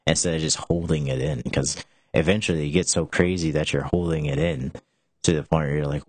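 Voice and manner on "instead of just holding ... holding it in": ordinary talk, nothing marked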